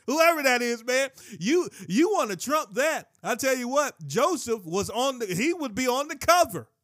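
preacher hyping Joseph's looks; animated preaching. Real, not acted. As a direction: hype it up with a grin. Preacher gets animated about looks.

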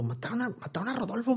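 high, urgent imitated friend; friend breaking news. Friend telling him of the killing, high urgent voice. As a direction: squeak out the news like the shaken friend. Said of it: "Y yo, ¿Qué pasó?".

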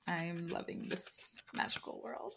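hushed breathy whisper of delight; whispered aside. Retold or imitated. To her co-host about fantasy books, whispering. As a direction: whisper it like a secret thrill. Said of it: "I'm like".

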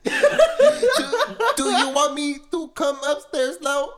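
high, loud mock Filipino accent. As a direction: put on a hammed-up Filipino accent. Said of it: "that was my best Filipino accent".

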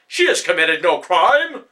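loud, slow, indignant protest; angry yell. A narrator's reading. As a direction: defend her with full force. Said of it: "Hannibal yelled out".

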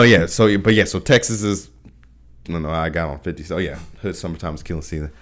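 ordinary talk, nothing marked

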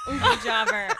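high, loud, laughing repeat. Real, not acted. Friends laughing at a misspoken phrase, high laughing repeat. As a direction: crack up on the words.

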